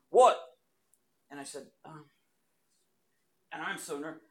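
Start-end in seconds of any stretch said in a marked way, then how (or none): 0.1-0.4 a curt New Jersey "What?"; New Jersey accent. Retold or imitated. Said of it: "They talk like this".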